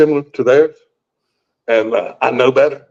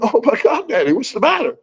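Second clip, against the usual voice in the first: high, alarmed daughter voice; worried daughter; 9 semitones higher than usual. Retold or imitated. Daughter finding her dad in a panic attack, alarmed high. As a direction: rush in anxious for her father. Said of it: "she comes running in the room".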